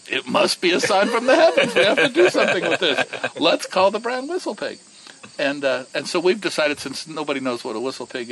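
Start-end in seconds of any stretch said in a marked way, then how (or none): 0.1-4.8 high, loud, laughing imitation of Raj; mock Raj. Speaker teasing Raj with his own words, high and laughing. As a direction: repeat Raj's line high with a laugh. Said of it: "And I look at him and I go".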